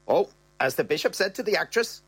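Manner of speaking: saucy quip; Bernie's voice. Retold or imitated.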